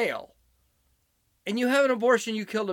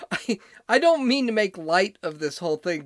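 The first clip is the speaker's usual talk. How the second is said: usual speaking voice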